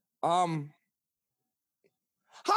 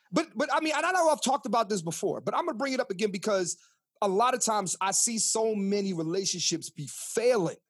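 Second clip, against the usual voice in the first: ordinary talk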